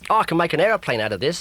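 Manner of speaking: put-on high, loud children's greeting; cheerful kids. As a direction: call out happily as they run in.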